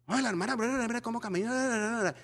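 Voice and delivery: ordinary talk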